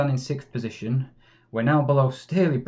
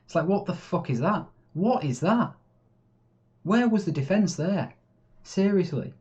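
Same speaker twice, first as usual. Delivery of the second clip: exasperated; 5 semitones higher than usual. Real, not acted. Opponent scores, exasperated.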